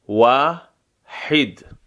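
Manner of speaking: واحد said slowly with rounded lips on the w and a falling tune; slow Arabic wahid. Shown as a demonstration. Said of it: "برای تلفظ واو لبها حالت غنچه ای".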